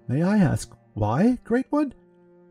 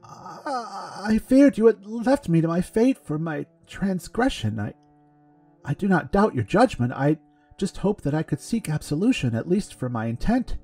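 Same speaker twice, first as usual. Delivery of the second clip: high, tearful, trembling plea; shaking voice; 9 semitones higher than usual. A narrator's reading. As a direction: voice shaking with tears. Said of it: "Penton's voice shook".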